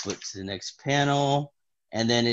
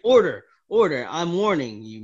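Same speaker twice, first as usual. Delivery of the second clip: loud, raised courtroom call; stern judge; 6 semitones higher, 7 dB louder than usual. Retold or imitated. Judge warning the defendant, acted stern call. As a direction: call the room to order, acted. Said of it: "the judge's like".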